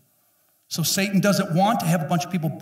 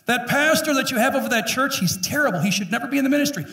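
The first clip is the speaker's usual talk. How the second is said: higher, whiny griping; mock complaint; 9 semitones higher, faster than usual. Wife complaining to God about the pastor, whiny. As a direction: gripe like a petty critic.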